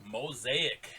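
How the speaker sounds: ordinary talk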